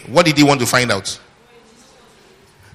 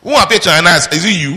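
loud, high-pitched rhetorical question; insistent challenge; 6 semitones higher, 5 dB louder, faster than usual. Real, not acted. Preacher challenging the congregation on who appeared, loud and insistent.

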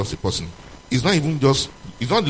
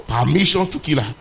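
ordinary talk, nothing marked

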